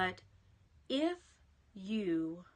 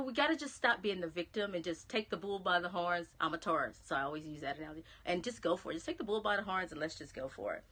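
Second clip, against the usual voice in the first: usual speaking voice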